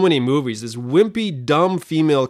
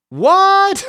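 mock astonishment; 6 dB louder than usual. Adam was there all along.

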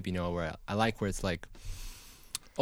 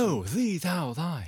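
bad British accent; 6 semitones higher, much slower than usual. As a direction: ham up an archaic British voice. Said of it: "do a better British accent".

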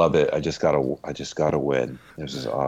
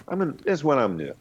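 fast and higher; rushed; 7 semitones higher, much faster than usual. Real, not acted. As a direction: spit it out quickly. Excited rant.

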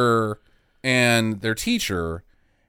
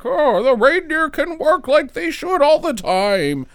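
high, bright mock Santa; lightly voiced jolly Santa; 13 semitones higher than usual. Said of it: "Santa's like".

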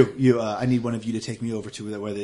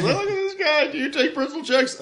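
very high incredulous clerk; high mocking voice. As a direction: sneer at the silly customer.

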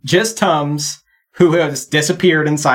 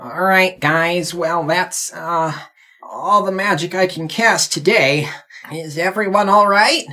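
weary, higher character voice; tired character; 3 semitones higher than usual. Acted. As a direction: address the group as a worn-out spellcaster. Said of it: "He looks pretty tired".

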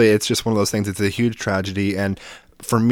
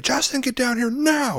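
dad calling urgently. Retold or imitated. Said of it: "my dad is like".

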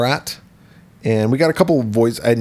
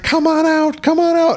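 much higher sing-song coaxing. Retold or imitated. Henry luring his wife toward the crate, high sing-song coax. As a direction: lure her in like calling a pet.

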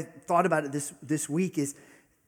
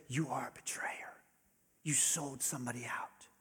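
hissed whisper of an inner accuser; accusing whisper; 9 dB quieter than usual. Acted. Pastor voicing the voice of despair, accusing whisper.

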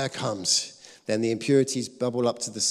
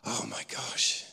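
breathless gasp, then a soft whisper; quiet awed whisper after a sharp gasp; gasp of wonder; 6 dB quieter, slower than usual. Retold or imitated. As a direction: gasp as if breathing freely for the first time.

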